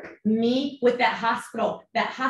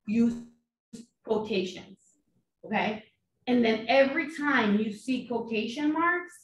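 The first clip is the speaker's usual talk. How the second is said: usual speaking voice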